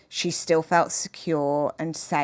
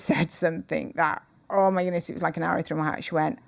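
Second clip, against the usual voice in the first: ordinary talk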